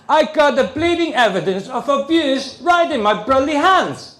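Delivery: furious dad at social services; angry protest. Retold or imitated. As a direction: shove the evidence at them. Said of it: "So I say to social services".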